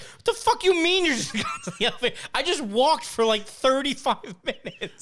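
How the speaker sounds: high-pitched, loud comic tirade; mock outrage. Hiker hearing friend rode to the elevator, outraged. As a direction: protest incredulously, voice shooting way up.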